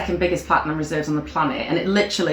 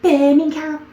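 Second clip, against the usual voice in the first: Birmingham in a high, put-on Scouse accent; saying the city name with a Liverpool twang; mock Scouse accent; 10 semitones higher, 4 dB louder than usual. Trying a Liverpool accent on a city name, Scouse accent. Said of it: "You said Birmingham in a Scouse accent".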